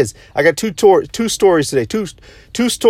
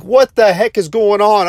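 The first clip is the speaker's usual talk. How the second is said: retold panic; 7 semitones higher than usual. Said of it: "I wake up in panic mode".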